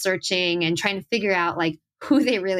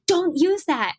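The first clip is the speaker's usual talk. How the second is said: mock snappy protest; 9 semitones higher, slower than usual. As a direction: bark it back like a touchy teen. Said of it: "And I'd be like".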